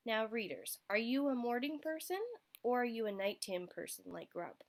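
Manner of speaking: usual speaking voice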